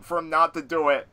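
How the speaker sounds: normal talking voice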